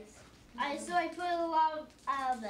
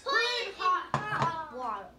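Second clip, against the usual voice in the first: raised voice, calling out the answer; louder; 4 dB louder than usual. Real, not acted. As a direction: belt it out when told to speak up. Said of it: "Say it louder, guys".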